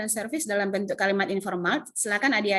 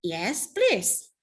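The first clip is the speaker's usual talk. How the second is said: happy. Shown as a demonstration. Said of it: "mukanya harus semeringah, nggak boleh muka sedih".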